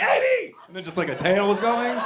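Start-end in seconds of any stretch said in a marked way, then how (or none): 0.0-0.5 very high, loud squeal of a name; hammed-up joyful call to a friend; excited buddy greeting. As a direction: shriek it like an overjoyed pal. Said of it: "If you had a buddy who was like".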